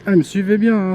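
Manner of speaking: excited. Real, not acted. Rider kept up with him.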